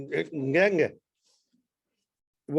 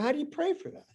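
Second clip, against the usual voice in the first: higher, half-voiced puzzled asking; the host wondering how to pray for persecuted friends, baffled; baffled inner question; 9 semitones higher, 5 dB quieter than usual. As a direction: ask yourself, stumped. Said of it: "And I'm going".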